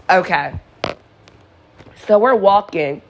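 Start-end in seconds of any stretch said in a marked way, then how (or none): 0.0-0.5 imitated weary sigh, then a grudging okay; exasperated. Reluctant friend agreeing to walk a drunk neighbor home, exasperated. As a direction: give in with an eye-rolling sigh. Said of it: "rolls his eyes and he's like".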